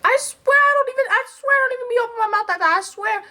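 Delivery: put-on very high, squeaky child's protest; mock whiny kid. Kid protesting to parents about being talkative, high mock whine. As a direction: rush through a whiny, pleading denial.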